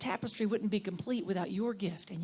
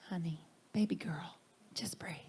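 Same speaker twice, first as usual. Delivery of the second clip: soft whispered comfort, lower; gentle whisper; 3 semitones lower, 5 dB quieter than usual. Retold or imitated. Teacher calming a panicking new minister, soft whisper. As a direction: hush it like a kind mentor soothing her. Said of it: "he said".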